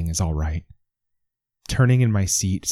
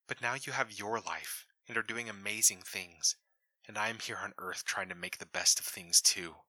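much softer, hushed and heartfelt; tender letter reading; 13 dB quieter than usual. A narrator's reading. Deputy reads Brian's letter to Hux, hushed and tender. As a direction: read it like a confession of love.

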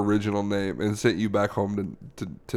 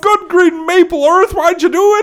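very high, loud wail; anguished shout; 11 dB louder than usual. Acted. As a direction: shriek the demand at full volume. Acted quarrel at its peak.

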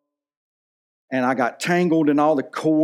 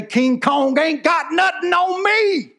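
much higher, slow, swaggering movie tough-guy quote; Denzel Washington swagger; 14 semitones higher, 3 dB louder, slower than usual. Retold or imitated. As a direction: strut it out like a cocky movie cop boasting. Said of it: "just like Denzel Washington said in Training Day".

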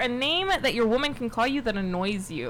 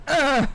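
short mock grunt, pitched up; imitated male grunt; 5 semitones higher, 4 dB louder than usual. Woman imitating a guy during sex for the host, mock grunt. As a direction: imitate a guy's involuntary grunt. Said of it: "like a guy when he's like".

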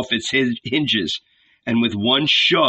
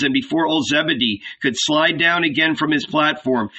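ordinary delivery, nothing marked

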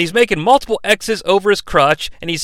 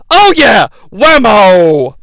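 mock cheer; 3 semitones higher, 6 dB louder, much slower than usual. Imitating Shane at the guitar shot. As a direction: bellow like a cartoon announcer. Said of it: "voicing commentator".

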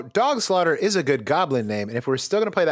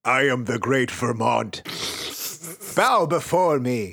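slow, booming monster decree; megalomaniac rant; 3 semitones lower, slower than usual. Acted. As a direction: proclaim slowly like a self-crowned god. Playing the lake monster.

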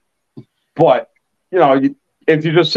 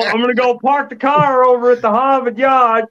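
put-on Boston accent; 8 semitones higher than usual. Teasing the caller he thought was from Boston, in a Boston accent. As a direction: lay on a thick Boston accent for laughs. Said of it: "I'm just waiting for you to go".

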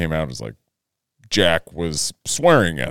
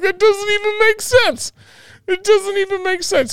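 squeaky, high, laughed-out protest; helpless laughter; 3 dB louder than usual. Retold or imitated. Dad reacting to son's nonsense insult, helpless laughter. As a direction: retell it cracking up, pitch shooting way up. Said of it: "I just start laughing".

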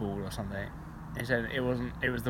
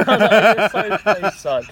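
laughing; 7 semitones higher, 10 dB louder than usual. Retold or imitated. Retold reaction, laughing.

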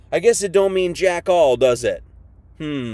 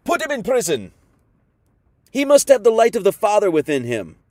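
higher and louder, pleading with the crowd; urgent pleading shout; 3 semitones higher, 6 dB louder than usual. Retold or imitated. Barabbas warning the crowd, pleading loud and high. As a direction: cry out that they're psychopaths, much louder. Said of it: "he was probably like".

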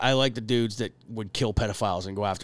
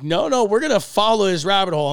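mock-excited, higher and louder; eager coworker; 8 semitones higher, 7 dB louder than usual.

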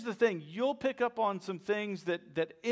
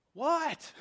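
high-pitched, acted "what?"; startled reply; 9 semitones higher than usual. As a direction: answer a sudden holler, re-enacted.

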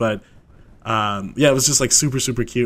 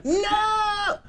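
high squealed no; playful shriek. Real, not acted. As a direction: squeal it, laughing.

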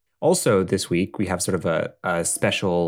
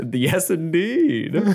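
mock grand; 8 semitones higher, much slower than usual. Slow mock-grand confirmation.

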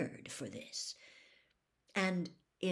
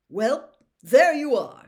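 very loud, higher, drawn-out announcement; hearty official; 6 semitones higher, 17 dB louder, slower than usual. Acted. Bagman rounds off the draw for the champions, booming. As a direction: boom it out like a jovial master of ceremonies.

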